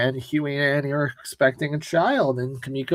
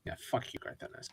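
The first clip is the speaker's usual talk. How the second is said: very soft, voiceless jab; barely audible grumble; muttered aside; 3 semitones lower, 18 dB quieter, faster than usual. Real, not acted. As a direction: mutter the insult under your breath. Muttered jab at comic's author.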